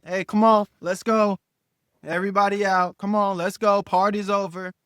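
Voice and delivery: loud, insistent calls to leave; herding guests out. Retold or imitated. Acting out clearing guests out.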